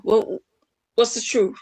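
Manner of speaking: normal talking voice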